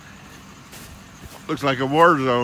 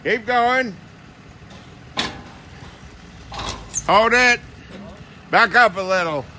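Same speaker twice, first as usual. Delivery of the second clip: calling out; 8 semitones higher than usual. Real, not acted. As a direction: guide the driver from outside. Directing driver backing up.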